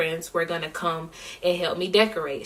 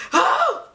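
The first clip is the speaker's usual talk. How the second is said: very high-pitched excited "oh"; excited squeal. Retold or imitated. Singer telling viewers about show day, excited squeal. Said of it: "y'all, so excited".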